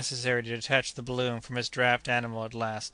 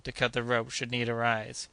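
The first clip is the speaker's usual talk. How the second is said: usual speaking voice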